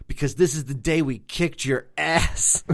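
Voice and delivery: lightly voiced English lady teasing; British girlfriend. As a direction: Fourth of July diner teasing the waiter, mock British. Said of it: "his girlfriend goes".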